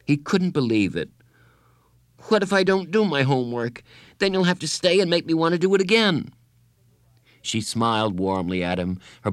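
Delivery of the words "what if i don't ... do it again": put-on high, childlike pleading; desperate bargaining. A narrator's reading. Boy bargains with his counselor to stay, desperate. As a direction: argue urgently in a raised boy's pitch.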